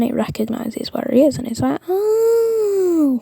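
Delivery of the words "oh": long, high rising-and-falling "oh"; dawning realization. Acted. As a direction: stretch out a high-pitched mock revelation. Character's realization acted out.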